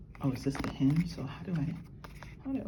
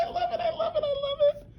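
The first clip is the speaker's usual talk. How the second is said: very high, giggling "I love it"; laughing with joy. Real, not acted. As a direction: gush through laughter. Bracelet finally fits.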